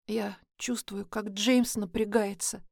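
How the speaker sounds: ordinary delivery, nothing marked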